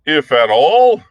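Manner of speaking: roaring Dak Kova. A narrator's reading. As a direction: bellow it back in outrage. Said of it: "roared Dak Kova".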